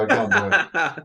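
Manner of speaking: high, slow laughing refusal; flustered and giggling; laughing protest. Real, not acted. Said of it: "you put me under pressure".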